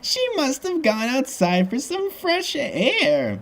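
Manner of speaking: put-on very high, slow, syrupy old lady voice; sweet old lady. A narrator's reading. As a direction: coo it sweetly in a drawn-out falsetto. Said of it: "Missus Bigsley says sweetly".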